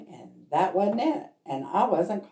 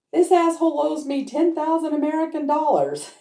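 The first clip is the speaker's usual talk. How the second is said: high, louder mock outburst; retold outraged complaint; 10 semitones higher, 5 dB louder than usual. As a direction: exclaim it as an indignant husband. Husband's retold complaint about an unpaid debt.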